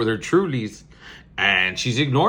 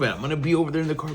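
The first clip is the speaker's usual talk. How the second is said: normal talking voice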